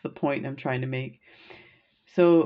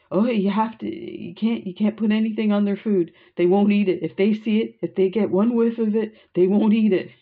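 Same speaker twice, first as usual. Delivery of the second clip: retelling a frantic warning, higher and urgent; 5 semitones higher, 4 dB louder than usual. Retold or imitated. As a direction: imitate him panicking about the food, not angry. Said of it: "frantic, like, not angry with me, but frantic".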